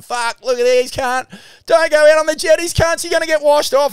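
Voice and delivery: put-on high, loud warning shout at kids on the jetties; Nicky yelling. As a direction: bellow a blunt warning over the storm. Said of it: "you got Nicky going".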